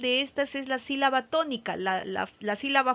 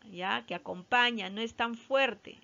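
usual speaking voice

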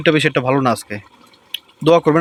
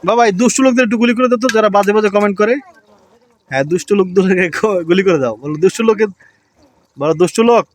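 put-on childlike coaxing; 6 semitones higher, 3 dB louder than usual. Father coaxes child, high voice.

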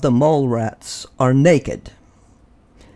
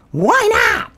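much higher questioning tone; high curious voice. A narrator's reading. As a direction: ask it with surprise. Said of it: "Grandpa asked".

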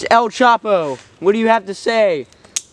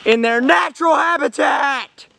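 shouted narration; 7 semitones higher than usual. Pretend. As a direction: belt out the narration. Mock documentary, shouted.

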